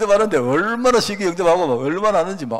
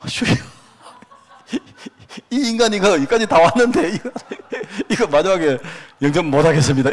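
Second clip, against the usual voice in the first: half-laughing, higher, louder plea to the Lord; laughing mock prayer; 3 semitones higher, 5 dB louder than usual. Pastor retelling his anxious prayer to the Lord, laughing. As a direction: laugh through a retold panicky prayer.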